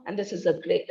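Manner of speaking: ordinary talk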